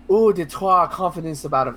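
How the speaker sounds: a light, playful French accent on "eau de toi confidence". Shown as a demonstration. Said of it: "I don't know why I'm doing a French accent".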